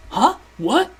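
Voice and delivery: ordinary delivery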